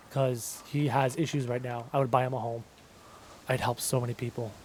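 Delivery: low, soft and heartfelt; getting emotional. Real, not acted. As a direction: speak quietly, moved by the thought of helping family. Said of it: "I'm getting emotional thinking about it".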